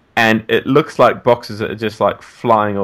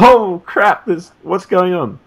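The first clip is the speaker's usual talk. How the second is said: startled; 9 semitones higher, 4 dB louder, slower than usual. Retold or imitated. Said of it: "And I was like".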